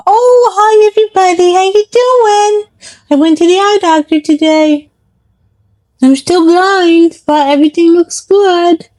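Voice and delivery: cute high dog voice greeting fans; voicing the dog. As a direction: speak as the little old dog.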